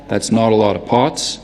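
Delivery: Boston "aw" in "not", "lot" and "pots"; rounded Boston vowel on "lot" and "pots"; lip-rounded "aw" vowels, like a Bostonian; Boston accent. Shown as a demonstration. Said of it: "If they say it with an aw".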